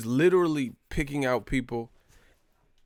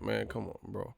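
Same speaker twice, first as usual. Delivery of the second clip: normal talking voice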